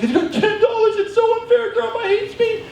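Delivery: very high, squealed mock wail; hammed-up tantrum in a tiny high voice; upset little brother. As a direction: wail like a wronged child. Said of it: "he's just getting so upset".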